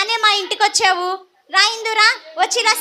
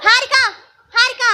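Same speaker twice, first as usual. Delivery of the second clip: calling out; 4 semitones higher, 3 dB louder than usual. Acted. Mistress calling for the maid.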